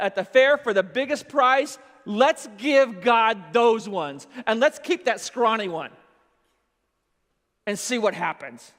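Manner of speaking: loud, slow, fervent voice of a shepherd planning; a loud, deliberate inner monologue weighing the best sheep; drawn-out, loud Abel voice; Abel's eager voice. Retold or imitated. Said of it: "He also goes".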